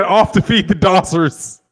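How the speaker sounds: slow, light imitation of a British accent; lightly voiced British accent; mock British accent. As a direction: read the chat line in a hammed-up British accent. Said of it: "he said".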